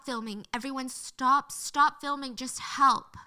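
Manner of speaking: imitated plea, punched loud on each command; retold outcry. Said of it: "People were crying out loud".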